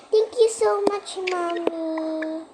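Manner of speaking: put-on high, sing-song little-kid thanks; pretend baby child. Baby thanks mommy in pretend play. (boses-batang mataas na nagpapasalamat)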